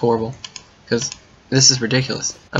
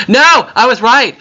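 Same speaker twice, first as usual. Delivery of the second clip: triumphant shout; 10 semitones higher, 9 dB louder than usual. Real, not acted. Finds the way home.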